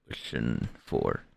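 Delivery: normal talking voice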